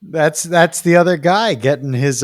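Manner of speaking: ordinary talk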